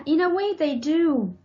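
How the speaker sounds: ordinary talk, nothing marked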